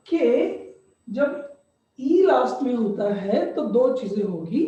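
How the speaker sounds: ordinary delivery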